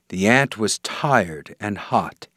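ordinary delivery

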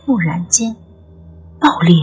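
ordinary talk, nothing marked

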